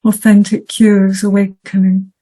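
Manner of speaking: measured, declaimed poetry reading with long pauses between lines; poem recitation. Acted. Poet reading Flying Sixteen to the audience, solemn recitation. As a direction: recite it evenly and deliberately, letting each phrase stand alone. Reads own poem after plain introduction.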